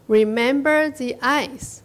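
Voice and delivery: emphatic, drawn-out teacher reading; slow read-aloud. Shown as a demonstration. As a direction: stretch each word for learners. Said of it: "我们慢慢读".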